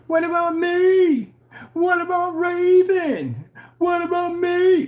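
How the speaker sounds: high, slow, drawn-out whine of a wrestler; mock the wrestler's whiny complaint, stretched out; whiny wrestler Raven. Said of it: "that freaking wrestler. He used to go".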